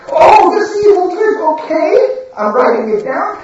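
high, bright eager student voice of the devil; mock eager shaitan. Devil taking notes in class, high and eager. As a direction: squeak it out like a keen pupil.

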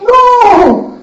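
an outraged priest insisting on a bigger fire; shrill priest. Retold or imitated. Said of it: "He said".

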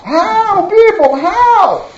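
high, stretched-out plea; slow, high-pitched incredulous appeal; exasperated. Acted. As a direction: implore the crowd to explain. Nuh exasperated with the people.

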